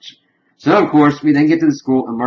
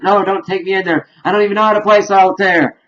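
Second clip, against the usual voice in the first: pleading panic; 8 semitones higher, 3 dB louder than usual. Retold or imitated. Grandpa begging not to go.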